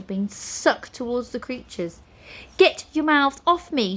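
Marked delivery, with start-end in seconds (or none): 2.6-4.0 high, loud indignant yell. A narrator's reading. Nine pulls her satchel back, yelling. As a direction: yell them away from you. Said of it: "she yelled".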